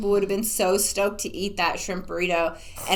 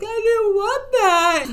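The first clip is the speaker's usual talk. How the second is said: high, loud, drawn-out whine; mock tearful kid's protest; little kid fighting tears; 14 semitones higher, 5 dB louder, slower than usual. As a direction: whine like a child on the verge of tears. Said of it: "like a little kid who's".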